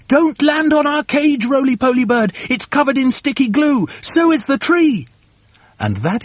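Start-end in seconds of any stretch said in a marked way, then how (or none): 0.1-5.1 high-pitched urgent shout; shouted warning. Acted. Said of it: "they shouted out".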